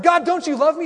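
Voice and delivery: high, pleading cry to God; mock wounded plea, voice jumping high; whiny plea to God. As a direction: beg like a hurt child. Congregation voiced crying to God.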